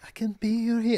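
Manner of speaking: put-on sung high in a Spanish accent. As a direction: croon it like a Spanish heartthrob. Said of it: "in a Spanish accent".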